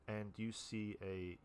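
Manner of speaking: usual speaking voice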